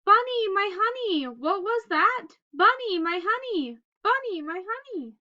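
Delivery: put-on loud, high calls for a lost child; mother rabbit calling across the field. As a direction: call out searching for him.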